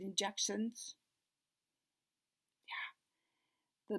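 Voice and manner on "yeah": soft unvoiced yeah; whisper. Real, not acted. Reader whispering agreement to the child. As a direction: whisper it close.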